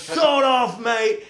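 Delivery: high, loud British yell; shouted order. Retold or imitated. Rocker yelling at the bartender before storming off. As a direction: bellow it at the bartender on the way out. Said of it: "And Zodiac just says".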